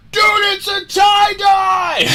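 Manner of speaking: very high, loud, slow yell; ecstatic shout. Retold or imitated. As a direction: shout it in total disbelief. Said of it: "and he goes".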